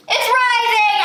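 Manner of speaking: excited. Real, not acted. Slime rising, excited.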